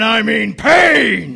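slow drawn-out shouted threat. Acted. Tough guy threat punchline.

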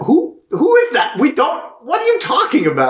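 high, incredulous retold questioning; mock disbelief. Retold guards baffled by her claim.

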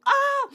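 a high, loud squeal of excitement; excited squeal. Real, not acted. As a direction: let out a burst of joy. Bursting with exciting news.

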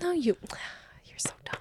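fast teasing whisper. Real, not acted. Teasing her cohost about his beer belly, whispered. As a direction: hiss it under your breath, fondly.

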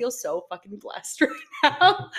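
giddy, with a laugh breaking through; happy laughing quote. Retold or imitated. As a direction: laugh as you say it. Reliving concert joy, laughing.

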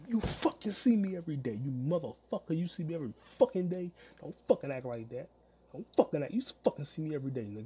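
loud, high indignant outburst; angry confrontation. Retold or imitated. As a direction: cuss him out loudly, swears punched hard. Acting out confronting the old man.

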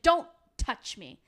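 "Don't touch me" snapped slow and loud; slow, loud mock outburst; mock angry shout. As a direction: bark it like a furious Carrie. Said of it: "she's like".

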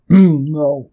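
throat-clearing, choked-up no; answering mid-choke on a drink; choking on coffee. Real, not acted. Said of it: "engasguei com café".

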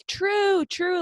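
high-pitched mock call for help; imitated yelling. Lost little brother calling for his sister, mock yelling. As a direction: mimic a panicked yell, not full volume. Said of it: "he's, like, yelling really".

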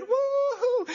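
high, thrilled woo-hoo; high cry of delight at a sale; exhilarated whoop. Retold or imitated. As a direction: cheer like you found the deal of the year. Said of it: "you're exhilarated".

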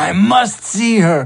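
urgent longing. A narrator's reading. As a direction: burst out with longing to see mother. Salva longs for his mother.

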